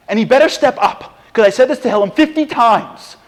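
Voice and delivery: high, loud, exasperated insistence; indignant demand. Acted. Self-centred believer makes demands of God, loud mock indignation. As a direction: lay down a loud ultimatum.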